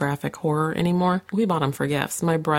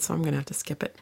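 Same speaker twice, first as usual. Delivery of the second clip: ordinary delivery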